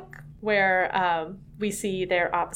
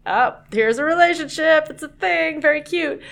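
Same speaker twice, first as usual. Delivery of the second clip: mock casual; 7 semitones higher, 6 dB louder than usual. Voicing the authors.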